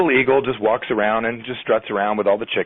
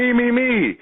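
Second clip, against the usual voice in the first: high, eager "me, me, me"; eager volunteers; 13 semitones higher, slower than usual. Retold or imitated. Everyone in the room volunteering, pitched high and eager. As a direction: crowd all volunteering at once, high and keen.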